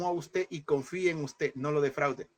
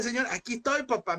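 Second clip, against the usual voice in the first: higher, ringing declaration to God; bold praise; 8 semitones higher than usual. Acted. Preacher urging the congregation to declare praise, high and fervent. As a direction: proclaim it with fervent faith. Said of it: "Levante alabanza y dígale".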